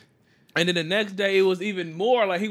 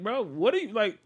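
incredulous outburst; 7 semitones higher than usual. Retold or imitated. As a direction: pitch shoots way up on the last words, baffled. Retold confused retort to coworker.

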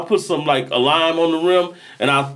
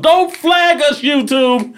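high-pitched plea; 11 semitones higher, much slower than usual. Acted. Plea to YouTube.

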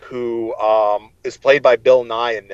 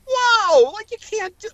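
high, mock-shocked squeal. Fan reacting to a book death, high mock-shocked squeal. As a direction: yelp it out like the book betrayed you.

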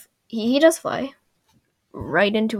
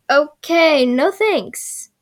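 raised pitch with a loud "Okay"; mock dismissive, higher than usual; Sokka refusing; 5 semitones higher, 5 dB louder, much slower than usual. Sokka turning down the ride, mock dismissive. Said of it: "And Sokka's like".

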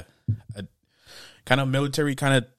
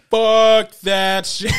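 higher, louder, slow drawn-out refusal; mock yell; 8 semitones higher, 7 dB louder, much slower than usual. Crew refusing the rubber-bullet stunt, loud and slow. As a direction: stretch it out loud like a flat refusal.

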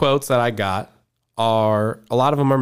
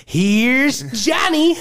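very slow, high and louder; creepy Shining voice; 14 semitones higher, 3 dB louder, much slower than usual. Retold or imitated. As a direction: drag out Johnny like a madman. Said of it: "I tried to pull out my inner Carrey".

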